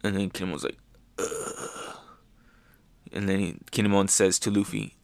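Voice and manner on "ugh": faint breathy groan of pain; weak groan. Retold or imitated. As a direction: groan like you're beaten down. Beaten Kinemon groaning.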